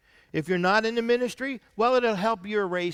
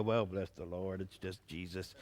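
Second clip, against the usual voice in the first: much softer, lower mock-humble murmur; 13 semitones lower, 14 dB quieter than usual. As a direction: imitate false modesty, drop to a hush. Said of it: "you get all humble and you go".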